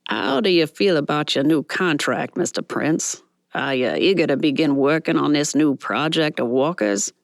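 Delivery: put-on cheeky reporter with a light Brooklyn accent. Cheeky reporter quizzing the star, light Brooklyn accent. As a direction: toss the question in a Brooklyn accent. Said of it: "with a thick Brooklyn accent".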